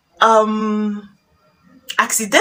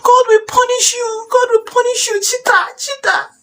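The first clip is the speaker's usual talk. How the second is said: very high wailing curses; hurling abuse; 3 dB louder than usual. Acted. Wife cursing the husband she suspects, high dramatic wailing. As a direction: rain down shrieky, melodramatic insults. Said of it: "Now you start reading abuse".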